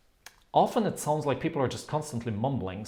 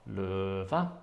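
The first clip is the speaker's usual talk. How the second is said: LØVE with a flat low LØ and a high VE; low tone, then high; 4 semitones lower, 3 dB quieter than usual. Shown as a demonstration. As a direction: keep LØ low without melody, lift VE. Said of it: "has the stressed syllable low".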